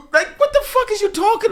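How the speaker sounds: high, loud mocking replay; mock character voice. Mocking the roof scene to cohost, high and loud. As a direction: ham up the bad scene. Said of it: "he went to go get the gun".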